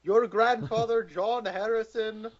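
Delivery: high, slow mock Irish brogue; a high, lightly done stage-Irish accent. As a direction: send up the old woman's Irish line. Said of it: "Oh, that dialogue".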